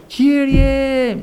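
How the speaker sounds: high, strained call in a different timbre; a high, strained, lightly voiced cry of Κύριε; imitated cry to the Lord. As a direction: mimic the wife crying out Κύριε. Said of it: "να φωνάζει".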